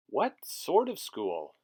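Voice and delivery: low, slow, different voice; shop lady's voice. Acted. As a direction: play a low-voiced shopkeeper. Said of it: "said the lady behind the counter".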